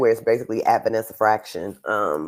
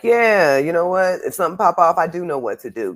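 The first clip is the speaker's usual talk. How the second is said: high, loud acted-out realization; imitated confidence; 8 semitones higher, 6 dB louder than usual. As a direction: play a student newly sure she can fight back. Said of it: "the looks on their faces when they're like".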